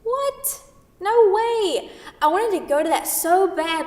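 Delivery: high, loud burst of envy; excited disbelief. Acted. Sarah hears Kent went to the gala, bursts out with high excited envy. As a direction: gush about the gala she missed. Envious about missed gala.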